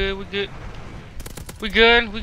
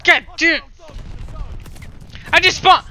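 frustrated gamer outburst; exasperated shout; 8 semitones higher than usual. Real, not acted. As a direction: yell it as you die unfairly. Killed again after spawning.

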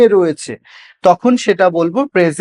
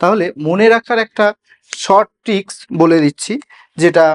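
usual speaking voice

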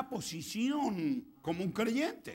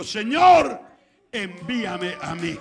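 very loud, drawn-out plea; fervent cry; 4 semitones higher, 16 dB louder, much slower than usual. Acted. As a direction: shout high, then slow down. Acts Isaiah answering God's call.

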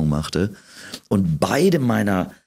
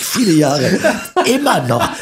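laughing; 11 semitones higher, 4 dB louder than usual. Real, not acted. Laughing at herself.

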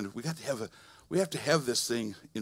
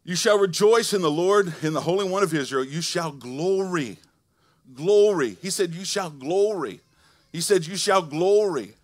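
loud, high preacher's call to rejoice; full-force preaching; 5 semitones higher, 9 dB louder than usual. Acted. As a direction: preach it out to the whole church.

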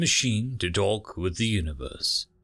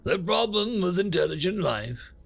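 lightly voiced universe, higher than narration; explaining universe; 7 semitones higher than usual. Universe telling humans a tale, lightly lecturing.

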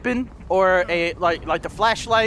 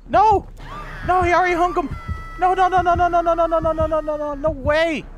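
dismayed shouting; 6 semitones higher than usual. Real, not acted. Teammate hung on hook.

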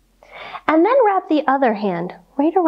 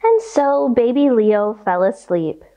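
ordinary delivery, nothing marked

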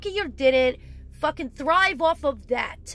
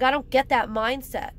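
ordinary talk

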